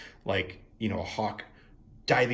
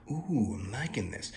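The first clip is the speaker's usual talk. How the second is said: normal talking voice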